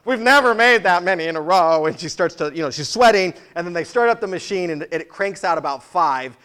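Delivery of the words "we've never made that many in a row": loud, panicked repeat; frantic ice cream lady, much louder; terrified server. Retold or imitated. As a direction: blurt it out like a frightened cashier. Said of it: "looks terrified and she says".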